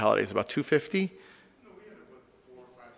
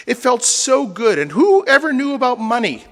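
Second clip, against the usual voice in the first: loud, high, impassioned imitation of a member; retold nostalgic fervor; 9 semitones higher, 7 dB louder than usual. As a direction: gush about the good old days, hammed up. Member's nostalgic plea, acted.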